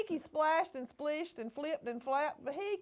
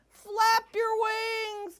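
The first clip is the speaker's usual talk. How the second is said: higher, slow calling to the falling bird; the bird calling to Beaky to fly; 9 semitones higher, 4 dB louder, much slower than usual. Acted. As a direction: call up encouragement. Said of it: "called the bird".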